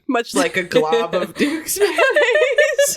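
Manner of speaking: high, slow, laughing through the words. Real, not acted. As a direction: crack up while reading. Laughing while reading.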